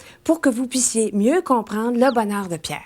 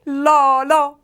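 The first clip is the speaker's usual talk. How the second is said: loud drill; 5 semitones higher, 5 dB louder than usual. Shown as a demonstration. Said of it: "Tout le monde ensemble".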